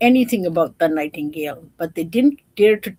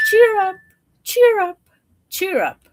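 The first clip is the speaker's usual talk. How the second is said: birdsong chirp; 12 semitones higher, 7 dB louder than usual. Acted. Nightingale singing.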